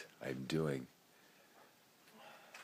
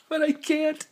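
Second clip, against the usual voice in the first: squeaky, much higher, tumbling out; giddy high voice; 8 dB louder than usual. Real, not acted. Trying to say how much he loves his friends, giddy and high.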